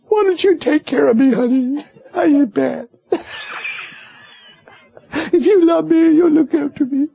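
high, hammed-up whine to be babied; pleading like a sulky child wanting pampering; mock whining. Mocking himself begging his wife for care, hammed-up whine. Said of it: "tell her how I feel".

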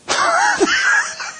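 high whooping laugh; knee-slapping laugh; big laugh. Acted. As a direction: let out a huge cackling laugh. God's laughter acted.

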